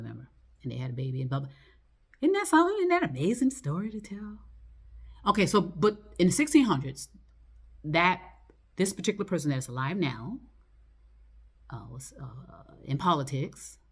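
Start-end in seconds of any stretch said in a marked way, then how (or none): 2.2-4.4 high, sarcastic wonder; mock amazement. Real, not acted. 11.7-12.7 slow secretive whisper. Real, not acted. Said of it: "unvoiced whisper".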